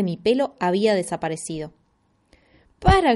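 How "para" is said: sudden high frightened yell; alarmed shout. Acted. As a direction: shout it in panic. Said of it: "grité espantado". (grito espantado)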